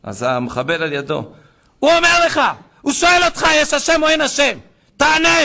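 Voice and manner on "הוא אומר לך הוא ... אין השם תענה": high, loud demand; shouted interrogation. Retold or imitated. Terrorist demanding an answer from a bound captive, shouting. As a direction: bellow it like a captor demanding an answer.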